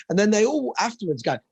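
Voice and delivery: ordinary talk, nothing marked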